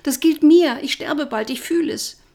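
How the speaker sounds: high, loud frightened wail. Acted. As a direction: lament that death is coming, voice high. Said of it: "jammerte ich". (hoch und laut jammernd)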